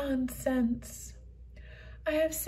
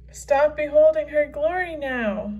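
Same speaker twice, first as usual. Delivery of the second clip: loud command; 2 semitones higher, 7 dB louder than usual. Acted. Breaks up the glory display.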